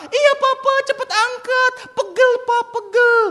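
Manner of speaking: put-on very high, loud little-boy wail; pleading child. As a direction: beg dad in a hammed-up toddler voice. Son begs with aching hand.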